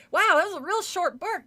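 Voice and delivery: high loud sarcastic wow; mock surprise. Real, not acted. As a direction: feign amazement at the short book. Mock surprise at short book.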